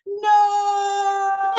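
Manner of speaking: high, loud laughing cry of no; a crowd's laughing shout of no; laughing scream. Retold or imitated. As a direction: yell it in mock horror, half laughing. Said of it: "everybody on Alpha Mark screamed".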